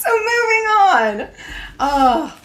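high giggly laugh. Real, not acted. Laughs at her own joke.

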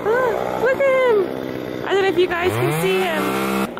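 high, slowed delight at the dragonfly. Real, not acted. Spotting her dragonfly.

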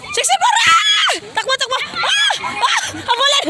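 high excited shouts; excited shouting. Real, not acted. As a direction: yell them on to run. Host shouting during the chase.

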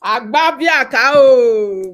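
loud, very slow sing-song call; hammed-up drawn call. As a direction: stretch the name out like calling a crowd.

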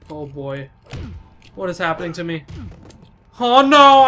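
normal talking voice

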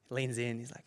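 normal talking voice

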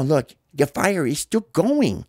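usual speaking voice

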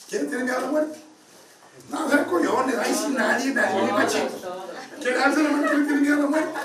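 high, loud challenge to the crowd; calling out. Real, not acted. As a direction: tease the audience at the top of your range. Teasing challenge to the audience.

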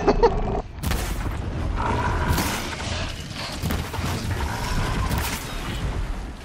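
breathless squealing giggle; a silent, high wheeze of a laugh; high wheezing laugh. Real, not acted. Gamer cracking up at the hit, breathless high giggle.

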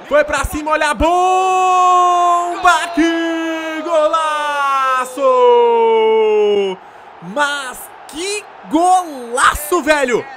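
long drawn-out goal scream; explosive goal commentary. Real, not acted. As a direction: stretch golaço out, loud and high. Goal scored in the game.

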